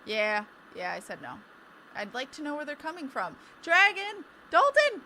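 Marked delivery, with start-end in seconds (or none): 3.6-4.2 loud high call-out; excited shout. Real, not acted. Calling out a donor's name. 4.5-5.0 loud high correction call; excited shout. Real, not acted. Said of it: "I don't know why I said dragon".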